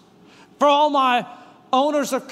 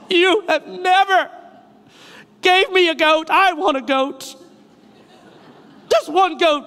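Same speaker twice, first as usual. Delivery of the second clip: pity-party whine; 3 semitones higher than usual. Retold or imitated. Pastor voices the older brother begging for a goat, whining. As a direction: beg Daddy like a sulky kid. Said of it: "president of the pity party".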